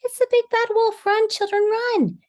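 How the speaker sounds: very high, loud panicked hen; squawking. A narrator's reading. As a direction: shriek a warning as a frightened hen. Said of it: "squawked Missus Chicken".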